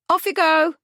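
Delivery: very high, loud parrot squawk; brisk parrot. A narrator's reading. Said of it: "remarked the parrot briskly".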